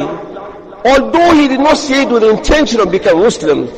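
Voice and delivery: usual speaking voice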